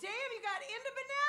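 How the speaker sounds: surprised. Real, not acted. Surprised joking exclamation.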